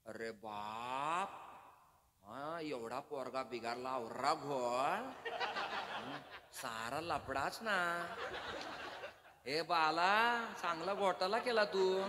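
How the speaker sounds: slow, laughing village dialect; rural dialect. Retold or imitated. Preacher imitating a villager near Thane, laughing. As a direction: stretch the words like a country local. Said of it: "जिल्ह्यातल्या भाषा जवळजवळ कळतात".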